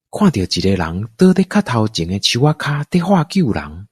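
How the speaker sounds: usual speaking voice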